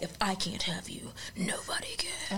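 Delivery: breathy menacing whisper. Retold or imitated. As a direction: hiss the threat under your breath. Stalker's threat in retold story.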